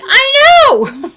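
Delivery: high, loud fake cry; mock anguished wail. Mother agreeing with her kids, hammed-up wail. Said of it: "I'm like".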